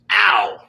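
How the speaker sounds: loud hammed-up ow; mock pain yelp. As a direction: yelp like a cartoon villain hit. Acted punch.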